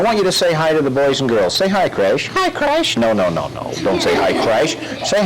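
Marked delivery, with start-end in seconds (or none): 2.3-3.0 high silly puppet parroting. Acted. Dummy parrots the officer, high puppet voice. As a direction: squeak it back.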